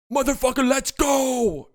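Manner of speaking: high, strained mock yell; Luka Doncic trash talk. Guessing what Luka said here, high mock yell. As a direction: yell it like a fired-up player. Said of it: "let's try to dissect what Luka said".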